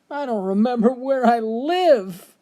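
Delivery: hammed-up, high-pitched lament; mock crying old man. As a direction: imitate a tearful old man wailing the punchline. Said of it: "he was crying his eyes out".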